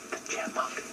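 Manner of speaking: faint breathy little voice from behind; tiny voice. Acted. Chipmunk calling from behind the bear, tiny and faint. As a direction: whisper it like a tiny creature far off. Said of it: "in a little tiny voice".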